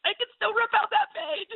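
high squeal clutching the book; half-laughing desperate plea; pleading, laughing at her own panic. Retold or imitated. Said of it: "hold on to the book like".

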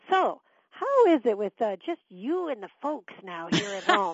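oldest son's pointed question. Retold or imitated. Oldest son asks brother as if parents were absent, mock-casual. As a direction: imitate a son slowly probing his brother. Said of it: "acting as though John and I, my husband and I, weren't really there".

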